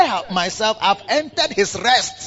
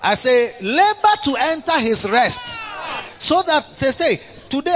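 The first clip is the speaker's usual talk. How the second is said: preaching shout; 7 semitones higher than usual. Real, not acted.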